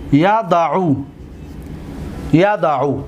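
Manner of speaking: ordinary talk, nothing marked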